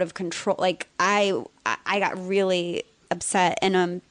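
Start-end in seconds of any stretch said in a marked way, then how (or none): none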